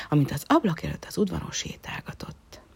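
ordinary delivery, nothing marked

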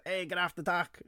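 a hint of the dock guy's holler; imitating Artie. Counselor mimicking the camp boat guy's catchphrase, lightly done. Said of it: "you know, the ... guy".

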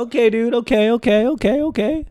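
high, small anxious voice; acted-out uneasy appeasement; nervous placating.

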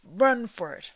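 loud urgent shouting, rising at the end. A narrator's reading. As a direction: yell them toward the door. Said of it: "shouted Gandalf".

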